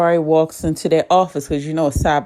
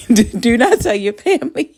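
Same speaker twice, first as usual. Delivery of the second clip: laughing plea; 6 semitones higher than usual. Retold or imitated. Carrie begging Zeke, laughing.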